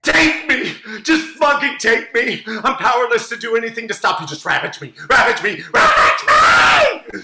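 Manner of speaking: building to a high screaming plea; melodramatic shout. Acted. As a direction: scream it, overwrought passion. Throws himself on the bed.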